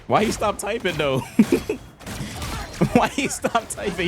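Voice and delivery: high, amused question broken by laughter; crying with laughter. Real, not acted. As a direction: keep cracking up as you ask. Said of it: "Oh, I'm crying".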